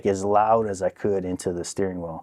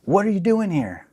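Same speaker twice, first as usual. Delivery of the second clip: high-pitched startled question; imitated caught wife; 10 semitones higher than usual. Cheating wife caught by her husband, mock startled. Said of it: "and I hear".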